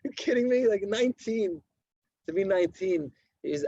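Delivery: high, far-off voice of disbelief; mock astonishment; incredulous disbelief. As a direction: act stunned that nineteen counts as old. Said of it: "I was like".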